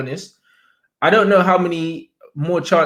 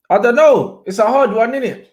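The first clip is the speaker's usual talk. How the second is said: higher and louder, torn over the choice; exasperated indecision; 7 semitones higher, 4 dB louder than usual. Real, not acted. Streamer to chat, loudly torn between managers. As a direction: throw up your hands, it's a hard one.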